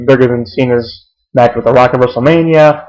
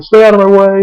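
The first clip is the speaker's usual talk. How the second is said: high-pitched mock order, only mildly yelled; put-on high holler; retold mock scream; 8 semitones higher than usual. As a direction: imitate a boss ordering someone off. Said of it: "Ace screams at him".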